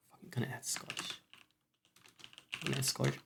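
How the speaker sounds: mock Scottish growl, mostly unvoiced; half-hearted Scottish voice; Scottish accent. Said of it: "I should add Scottish".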